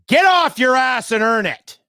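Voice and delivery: heated shout. Real, not acted. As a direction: bark it out, fed up. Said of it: "sorry for getting a little heated there".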